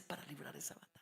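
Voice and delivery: urgent hushed whisper. Acted. Preacher drawing the congregation in, whispering. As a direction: pull them close with a whisper.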